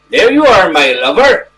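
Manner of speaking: a lightly put-on friendly Yorkshire wife; friendly Yorkshire accent. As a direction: greet him in a homely Yorkshire accent, bright and loud on the opening. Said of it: "she said in a friendly Yorkshire accent".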